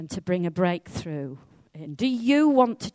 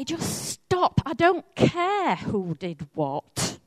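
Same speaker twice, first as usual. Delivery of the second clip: exasperated parent; 3 dB louder, slower than usual. Acted. Parent to children telling tales on each other, exasperated. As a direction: cut off the squabble, stretching the words.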